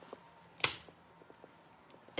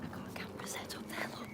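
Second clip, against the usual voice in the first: hushed whisper, voice off; 2 semitones lower, 20 dB quieter, faster than usual. Real, not acted. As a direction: whisper it secretly. Whispering so kids can't hear.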